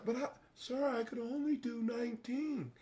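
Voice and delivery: soft, high, meek excuse; timid recruit. Retold or imitated. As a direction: shrink back like a scared recruit. Timid recruit answering sergeant.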